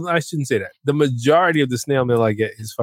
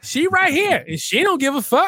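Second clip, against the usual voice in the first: mock clerk; 12 semitones higher than usual. Mocking the clerk.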